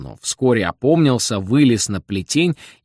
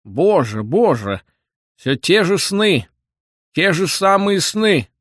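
put-on old man's high, slow wail; plaintive complaint; 7 semitones higher, slower than usual. A narrator's reading. Old man complains of his dreams, high plaintive wail. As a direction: lament it theatrically, voice pitched up. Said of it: "Произнеся эту жалобу, старик заплакал".

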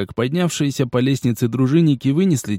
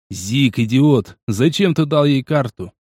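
ordinary delivery

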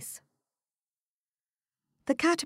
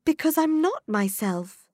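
put-on young Alice; 10 semitones higher, 5 dB louder than usual. A narrator's reading. Alice voiced in story.

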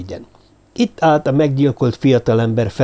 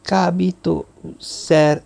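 put-on halting, higher-pitched girl's line; hesitant; 6 semitones higher, much slower than usual. A narrator's reading. Girl reluctantly naming the suspicion to Zombori, hesitant. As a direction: say it haltingly, syllables held apart.

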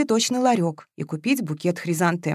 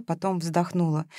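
plain reading voice

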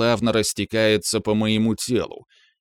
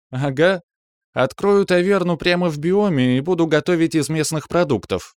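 higher-pitched, teasing female character; lightly voiced Калантриэль; 9 semitones higher, 4 dB louder than usual. A narrator's reading. Калантриэль jokes back to the narrator about a tavern, lightly voiced. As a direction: voice the woman a little higher.

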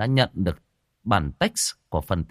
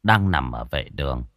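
lively village ghost-story telling; storyteller voice for a spooky folk tale; story narration; 5 dB louder than usual. A narrator's reading. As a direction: draw the listener into the scene. Story narration after the intro.

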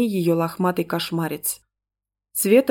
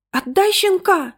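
put-on high, strained little-girl plea; frantic child pleading; desperate child's stage whisper; 12 semitones higher, 3 dB louder than usual. A narrator's reading. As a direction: beg urgently in a high, slowed voice. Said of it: "отчаянно зашептала она".

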